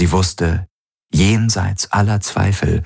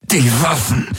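high, strained gasp through gritted teeth; pained, breathless urging; 7 semitones higher than usual. A narrator's reading. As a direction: pant it out through clenched teeth. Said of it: "keuchte er mit zusammengepressten Zähnen".

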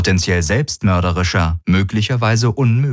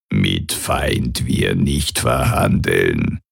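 much lower, slow, croaking broken alien speech; croaking alien Kilfer; 10 semitones lower, much slower than usual. A narrator's reading. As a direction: grind out the broken words like a toad creature.

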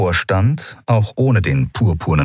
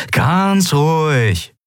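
very slow, soothing and drawn out; calming; 9 semitones higher, much slower than usual. A narrator's reading. Sephiron to the guards, slow soothing calm. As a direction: ease the tension, word by word.

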